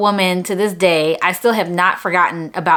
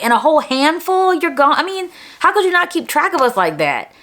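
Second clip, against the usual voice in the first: higher-pitched, aggrieved questioning; indignant complaint; 6 semitones higher than usual. Retold or imitated. Student silently blaming the teacher who lost track, indignant. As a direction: protest the unfairness, pitch climbing.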